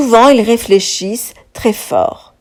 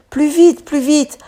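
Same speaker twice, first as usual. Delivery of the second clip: urging; 7 semitones higher than usual. A narrator's reading. Urging rowing.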